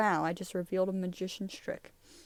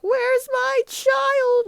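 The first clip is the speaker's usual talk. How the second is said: frantic parent; 11 dB louder, much slower than usual. Acted. Acting frantic parent in comic recap.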